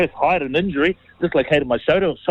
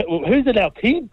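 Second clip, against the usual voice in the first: mock puzzled question, laughing through it; high and laughing, aping the Aussie press; laughing quote; 7 semitones higher than usual. Retelling the Aussie interview, laughing mock question. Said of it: "as they, they called it".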